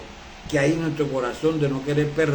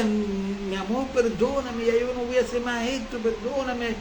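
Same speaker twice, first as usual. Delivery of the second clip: pleading husband; 5 semitones higher, 4 dB quieter than usual. Retold or imitated. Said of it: "y decía".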